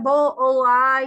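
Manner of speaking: बो, ओ, आई drawn out as separate sounds; teacher pulling apart बो, ओ, आई; sounded out in parts. Shown as a demonstration. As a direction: stretch बो, ओ, आई loudly, one piece at a time. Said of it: "उसका हिज्जा में".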